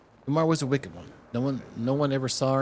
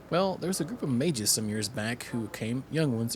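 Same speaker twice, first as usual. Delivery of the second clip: ordinary talk, nothing marked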